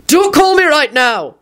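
high, drawn-out irritated call; shouting. A narrator's reading. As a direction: yell into the intercom without much volume. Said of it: "he shouted into it".

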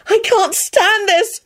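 put-on high, drawn-out girlish squeal; high-pitched exasperation; desperate squeal. A narrator's reading. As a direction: squeal in torment. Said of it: "Rosie squealed".

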